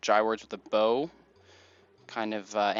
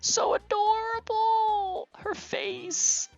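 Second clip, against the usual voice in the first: very high, drawn-out squeal of adoration; gushing; much slower than usual. Acted. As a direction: coo slowly over something unbearably cute. Gushing over cute character Rosie.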